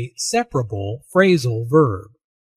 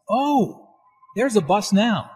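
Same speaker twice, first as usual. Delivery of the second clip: surprised; 7 semitones higher than usual. Acted. Spotting the bus.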